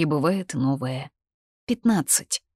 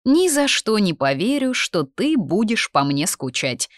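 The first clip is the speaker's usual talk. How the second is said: disbelieving; 6 semitones higher, 4 dB louder than usual. A narrator's reading. Sister keeps teasing in disbelief.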